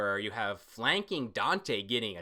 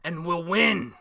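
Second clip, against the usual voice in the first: loud snarling villain voice; boastful villain shout; villain threat; 7 semitones higher, 13 dB louder than usual. Retold or imitated. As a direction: roar it like an unbeatable villain. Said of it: "Dante goes".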